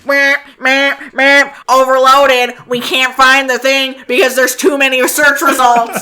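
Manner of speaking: high, loud glitching computer voice; a brain crashing under overload. Retold or imitated. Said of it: "my brain is like".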